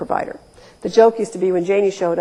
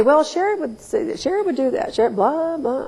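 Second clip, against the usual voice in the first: mock wife talk; 6 semitones higher than usual. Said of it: "it's so weird to say".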